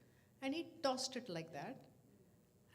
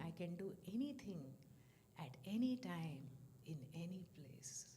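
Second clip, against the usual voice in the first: usual speaking voice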